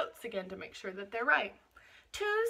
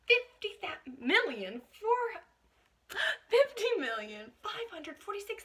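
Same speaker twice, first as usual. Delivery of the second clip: excited; 10 semitones higher, slower than usual. Acted. Tally false start, excited.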